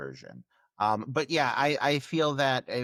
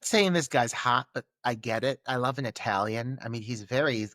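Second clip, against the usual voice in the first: ordinary talk, nothing marked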